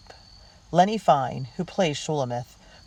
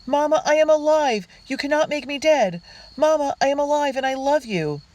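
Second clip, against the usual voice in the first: impassioned high, loud declaration; shouting; 12 semitones higher, 5 dB louder than usual. A narrator's reading. Estranged daughter proclaiming to Mama she is alive, shouting. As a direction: shout it insistently, pitched way up. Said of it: "Miriam shouts as she bursts through the door".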